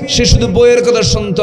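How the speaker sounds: high, loud complaint in a preacher's delivery; full-force preaching. Retold or imitated. Preacher voicing a mother's grievance against her son, full-force preaching. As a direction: cry out the mother's grievance to the crowd.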